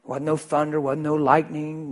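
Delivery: ordinary talk, nothing marked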